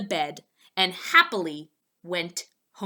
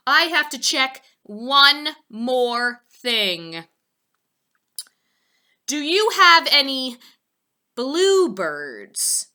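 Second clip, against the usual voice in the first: slow, louder deliberate pig voice; Poppleton; 4 semitones higher, 7 dB louder, slower than usual. A narrator's reading. Poppleton stalling the sales lady, slow and loud. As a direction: stretch it out as if checking.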